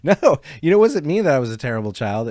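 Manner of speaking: loud, fast laughing denial; laughing protest. Real, not acted. Laughing denial of terrible child.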